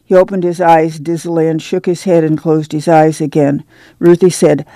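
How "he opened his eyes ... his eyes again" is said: plain reading voice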